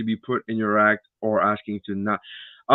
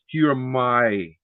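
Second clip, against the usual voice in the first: slow deliberate phrasing; 3 semitones lower, slower than usual. Shown as a demonstration. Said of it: "I would rather the phrase".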